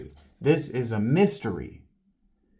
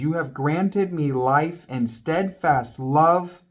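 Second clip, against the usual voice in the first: loud, higher pleading recitation; impassioned scripture reading; 5 semitones higher, 5 dB louder than usual. Acted. Job pleading with God, read in a loud impassioned voice. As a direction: declaim it like a desperate plea. Reads Job's plea aloud.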